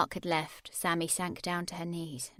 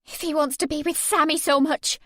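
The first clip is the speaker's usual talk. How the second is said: angry outburst, higher and louder; exploding; 8 semitones higher, 10 dB louder than usual. A narrator's reading. Narrator to Mark, exploding in anger. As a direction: burst out in fury. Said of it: "I exploded".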